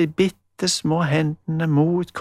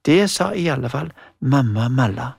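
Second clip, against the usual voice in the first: ordinary delivery, nothing marked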